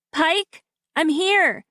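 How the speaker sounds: high call down the ravine. A narrator's reading. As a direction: call over the edge to him. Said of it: "I call".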